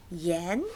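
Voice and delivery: "Yan" rising from bottom to top; "Yan" held with a rising tone; second tone. Shown as a demonstration. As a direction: slide the pitch of "Yan" upward. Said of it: "second tone".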